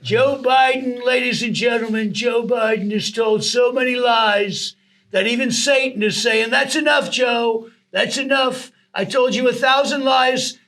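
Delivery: high, slow, drawn-out rally impression; Trump. Impressionist attacks a rival before the crowd, imitated rally voice. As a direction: hold each name loud and long like a politician at a podium. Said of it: "having a field day with Trump".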